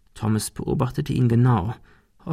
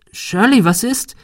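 alarmed; 11 semitones higher, 3 dB louder than usual. Acted. Shirley bursts in frightened.

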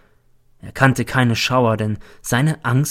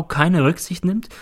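usual speaking voice

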